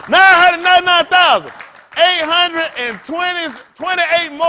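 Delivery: loud, high frantic counting; high shouted count, sperm voice; mock sperm counting. Voicing sperm counting itself.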